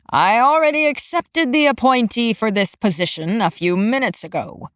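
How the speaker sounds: stern supervisor, matter-of-fact; gruff old supervisor. A narrator's reading. Supervisor speaks.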